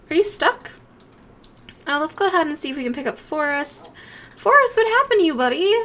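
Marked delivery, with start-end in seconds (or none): 4.4-5.7 high, loud worried call. Real, not acted. To the pet Forrest, a high loud concerned call. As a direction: sing out his name, concerned.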